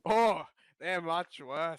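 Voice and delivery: put-on slow, drawn-out Scottish ogre accent; Scottish accent, stretching every word; Scottish Shrek voice. Friend asks for Shrek in a Scottish accent, slow Scottish brogue. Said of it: "Can you do Shrek's voice in, like, a Scottish accent".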